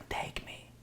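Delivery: very soft, tempting whisper. Acted. Gold tempting the thief in the safe, whispering. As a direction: whisper it like a secret lure. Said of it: "And it's whispering".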